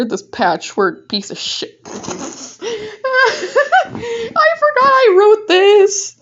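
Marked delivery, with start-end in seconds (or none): 1.9-4.5 high shrieking laugh. Real, not acted. Reader laughing at her cringe fanfic, high shrieking laugh. 4.6-6.1 high, loud, slow through laughter; laughing. Real, not acted. Laughing at forgotten fanfic, high loud laughing words. Laughing at her old writing.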